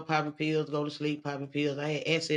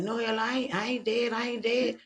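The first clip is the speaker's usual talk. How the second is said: higher, panicked protest; panicked denial; 5 semitones higher than usual. Retold or imitated. She argues with the voices, panicked and higher. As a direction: insist in a panic you are alive. Insisting she isn't dead.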